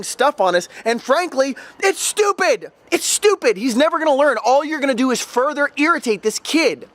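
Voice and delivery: high-pitched exasperated retort, peaking on "stupid"; exasperated rant. Retold or imitated. Tutor telling the interfering dad to back off, exasperated.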